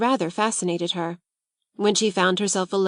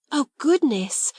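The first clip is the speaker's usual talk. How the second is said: slow, higher astonished exclaim; 6 semitones higher, slower than usual. A narrator's reading. Schoolgirl hearing her friend's offer, amazed. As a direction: marvel at the offer. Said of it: "exclaimed Ermengarde".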